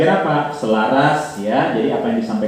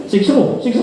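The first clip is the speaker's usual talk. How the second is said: high laughing mock answer; laughing joke; 11 semitones higher than usual. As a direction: tease the crowd with a laughing punchline. Joking answer about crying child.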